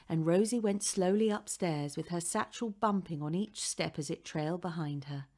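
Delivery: plain reading voice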